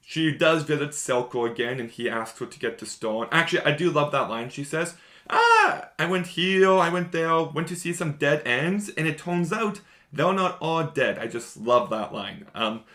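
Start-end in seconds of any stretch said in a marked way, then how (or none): 5.3-11.2 high, louder playful Jinx voice; mock Jinx. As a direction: tease it like the gleeful Jinx. Quoting Jinx's favourite line.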